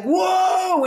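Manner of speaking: loud, high "whoa" of strain; excited whoa. Retold or imitated. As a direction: cry out in surprise at the effort. Ladies straining in Pilates.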